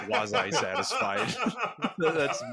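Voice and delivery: high, slow, laughing repeat. Real, not acted. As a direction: read it again through laughter. Rereads the phrase, laughing.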